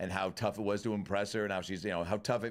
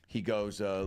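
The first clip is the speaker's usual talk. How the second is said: ordinary delivery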